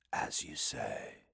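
low, slow whispering demon. Acted. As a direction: breathe it like a sly demon. Demon replies, amused.

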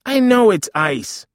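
high, loud, drawn-out protest; exasperated. Acted. Mother to son icing her nose, exasperated. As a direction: stretch it out, loud and irritated. Mother snaps back, bickering.

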